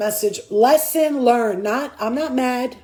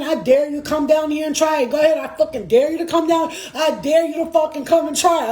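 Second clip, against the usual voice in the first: retold cursing-out. Human cursing out her spirit guides, defiant and heated. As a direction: re-enact a rant daring them to try. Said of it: "I yell at my guides".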